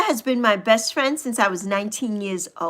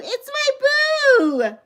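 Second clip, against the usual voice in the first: much higher, slow, crying out my boo; overjoyed squeal; 12 semitones higher, much slower than usual. Real, not acted. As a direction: wail it out in happy tears. Repeated greeting, rising excitement.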